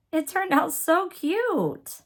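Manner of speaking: high, slow, gushing "so cute"; delighted. Real, not acted. Delighted by her finished piece.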